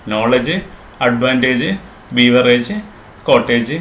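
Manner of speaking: നോളജ്, അഡ്വാൻറ്റേജ്, ബീവറേജ്, കോട്ടേജ് with full final vowels; common mispronunciations. Shown as a demonstration. Teacher listing what to avoid, full "-ayj" on അഡ്വാൻറ്റേജ്, ബീവറേജ്, കോട്ടേജ്. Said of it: "എന്നൊന്നും പറയരുത്".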